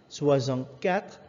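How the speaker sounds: ordinary delivery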